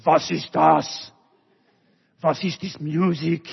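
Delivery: put-on angry-sounding German accent, slow and loud. Older brother storming in, in a German accent. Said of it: "he would give the older brother a German accent".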